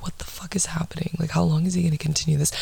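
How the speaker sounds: hushed, fast whisper of disbelief; inner whisper. Retold or imitated. Thinking to herself while her ex baby talks, whispered. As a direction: whisper it to yourself, freaked out. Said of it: "and I'm like".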